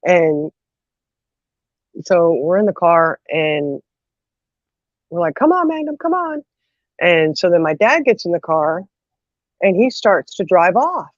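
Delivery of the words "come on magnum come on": high-pitched pleading call to a dog; urgent coaxing. Retold or imitated. Kids calling the dog into the car, urgent coaxing.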